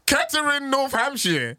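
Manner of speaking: high-pitched mock posh British accent; hammed-up posh English. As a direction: echo the place name in a teasing posh accent. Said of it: "okay, it sounds posh".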